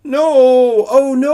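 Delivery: very high, very loud wailing no; high loud anguished howl. Acted. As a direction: howl in despair. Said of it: "Coyote howled".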